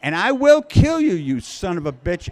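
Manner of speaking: mock the screaming woman being hauled out; hammed-up shrieked threat; retold scream. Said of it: "a woman out who's saying".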